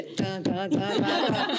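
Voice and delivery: mock beep sounds, tapped out one by one; rhythmic tan tan beeps, half laughing; imitating beeps. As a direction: play the child jabbing the button. Mimics child pressing the button.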